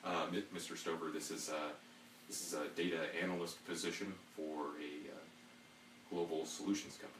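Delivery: low, soft formal questioning; interviewer voice. Pretend. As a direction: ask like a dry hiring manager. Plays a job interviewer.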